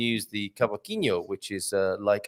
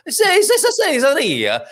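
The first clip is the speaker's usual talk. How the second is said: higher, louder hammed-up Italian accent; mock Italian; 13 semitones higher, 6 dB louder than usual. Host mocks learners going Italian, hammed-up Italian accent. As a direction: throw it out Italian-style with hands. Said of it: "pulled towards an Italian pronunciation".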